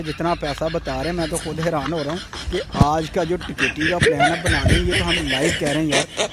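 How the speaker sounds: laughing. Real, not acted. Host laughing in disbelief.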